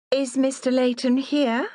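lightly voiced haughty lady; a touch of a lofty fine lady. A narrator's reading. Haughty customer asks for owner.

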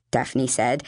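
ordinary delivery, nothing marked

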